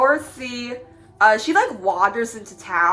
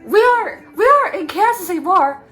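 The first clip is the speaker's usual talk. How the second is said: Dorothy voice; 8 semitones higher than usual. Retold or imitated.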